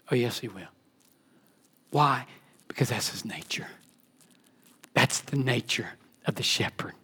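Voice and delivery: low, near-whispered certainty; hushed assurance. Real, not acted. Preacher assuring the flock of the shepherd's nature, hushed. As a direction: drop right down and confide it softly.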